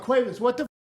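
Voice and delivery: high, indignant mock general; lightly voiced baffled general; exasperated general. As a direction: play the general caught off guard. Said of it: "And he'd be like".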